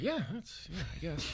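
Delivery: very soft, noncommittal mumbled reply; muted shrug. Acted. Kid answering friends about dad's TV show, muted.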